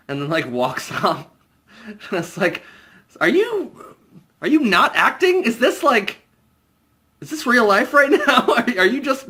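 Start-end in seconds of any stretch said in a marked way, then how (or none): 3.2-9.3 much higher, laughing disbelief; incredulous. Real, not acted. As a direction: ask as if you can't believe it. Baffled by the acting.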